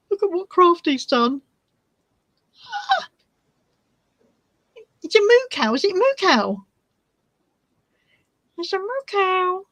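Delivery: thrilled gushing. Real, not acted. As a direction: gush over the cute moo cow. Excited at the knitted cow.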